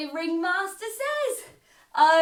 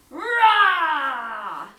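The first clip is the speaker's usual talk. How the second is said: loud playful roar; loud mock lion roar; big lion roar; 10 dB louder than usual. Shown as a demonstration. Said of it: "give me a big roar".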